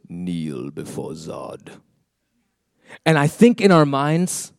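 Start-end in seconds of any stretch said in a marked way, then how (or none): 0.1-1.7 low, slow, menacing villain command. Retold or imitated. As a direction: growl it slowly like General Zod. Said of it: "and he goes".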